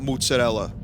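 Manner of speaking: "mozzarella" the New York way, first syllable "moots"; New York Italian pronunciation. Shown as a demonstration. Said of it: "and not mozzarella".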